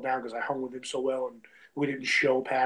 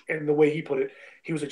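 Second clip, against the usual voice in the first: normal talking voice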